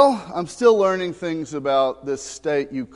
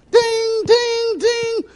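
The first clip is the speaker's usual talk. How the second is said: high, sing-song ding ding ding; mock game ding; 5 dB louder, much slower than usual. Pastor mimicking the kids' phone game, high chime. Imitating the game's sound effect.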